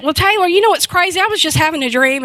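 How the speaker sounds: light up with sudden excitement; excited. Retold or imitated. Mother eagerly telling her son about her dream, excited.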